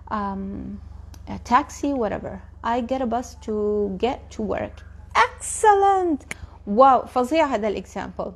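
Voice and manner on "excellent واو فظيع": excited praise. Real, not acted. Teacher thrilled by a student's example, excited praise. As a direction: cheer it out long and high, delighted.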